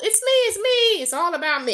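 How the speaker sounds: high, loud mock toddler. Teacher imitating a two-year-old for egocentrism, mock toddler voice. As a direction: imitate a self-centred little kid. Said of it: "two, three-year-old".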